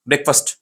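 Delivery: "ब्रेकफास्ट" run together as BREK-fust; short vowels, no split in "ब्रेकफास्ट"; said as one word. Shown as a demonstration. Teacher models it for students, "ब्रेकफास्ट" as one quick word. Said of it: "एक ही साथ में pronounce करना है".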